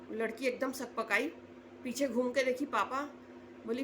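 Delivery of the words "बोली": ordinary talk, nothing marked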